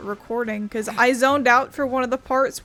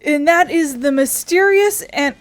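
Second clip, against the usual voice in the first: slow, louder showman's announcement; announcer voice; 3 semitones higher, 6 dB louder than usual. Acted. Streamer introducing a character to viewers, slow announcer voice. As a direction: present her like a grand reveal.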